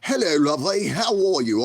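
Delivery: high, hammed-up British lord's greeting; mock British accent. Refusing to talk like the lords, mock British accent. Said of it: "not gonna talk like the lords".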